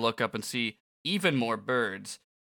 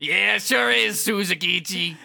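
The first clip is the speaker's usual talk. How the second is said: hammy villain parody; 7 semitones higher, 7 dB louder than usual. Pretend. Kubo agreeing with his scene partner, hammy. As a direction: answer with overblown swagger. Joking reply in Kubo's voice.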